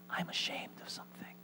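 very faint, hidden whisper; ashamed whisper. Acted. Ashamed child confesses to God, whispering. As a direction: whisper it as if hiding.

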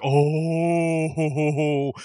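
long drawn-out crowd "oh"; crowd reaction. Retold or imitated. Wrestling crowd reacting to a promo turning real, long mock "ohhh". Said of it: "everybody's like".

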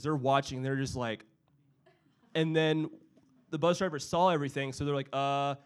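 plain reading voice

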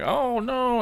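high, squeaky mock "oh no"; mock dismay. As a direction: play a whiny, hurt wrestler. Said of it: "and he was like".

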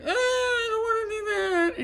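put-on very high, loud whining child; whiny kid. Child whining about getting ready. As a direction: whine like a small kid. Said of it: "My kids would be like".

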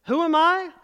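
boldly, with a new tone; switched tone. Acted. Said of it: "she switched her tone".

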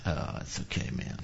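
quiet easygoing forgiveness; soft forgiving reply. Retold or imitated. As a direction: let him off gently, almost whispered. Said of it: "And I said".

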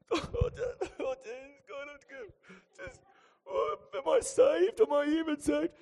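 high-pitched exaggerated wailing; mock crying. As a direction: act out sobbing in a high, hammed-up wail.

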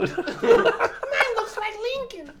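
very high squeaky voice; high put-on voice on the edge of laughter; silly family member. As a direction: play a tiny-voiced relative joining in. Plays another relative in car.

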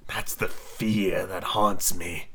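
low, soft, very slow; hushed, drawn-out villain; haunted Hook. Acted. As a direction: confess the dread slowly. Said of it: "Hook wetted his dry lips".

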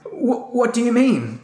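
high, slow, shaky question; trembling fear. Acted. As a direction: stammer it out, terrified. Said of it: "trembling all over".

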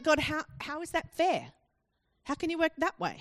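incredulous, questioning complaint; challenging God. Acted. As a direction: demand an explanation from God. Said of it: "beginning to play God ourselves and saying".

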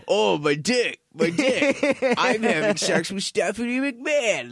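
high, silly put-on voice; mocking juvenile wrestlers. Fan mocks the new DX jokes, high silly voice. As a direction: send up a childish braggart.

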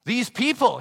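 put-on loud, high Scottish-accented protest; Scottish outburst. Old Scot protesting to the congregation in a Scottish accent. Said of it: "I'm gonna do a Scottish accent here".

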